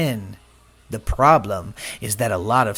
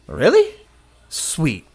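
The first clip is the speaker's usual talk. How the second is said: mock surprised; 3 semitones higher, 4 dB louder, slower than usual. Shallow convert's happy reaction.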